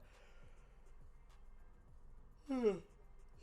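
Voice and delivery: long loud breathy yawn; big yawn. Real, not acted. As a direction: let out a real sleepy yawn. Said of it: "Excuse me. Sorry, that is so rude".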